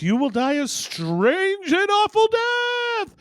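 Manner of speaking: high, slow eerie doom voice; ominous voice. Acted. Unseen voice curses the third explorer, slow and eerie. Mysterious voice in joke.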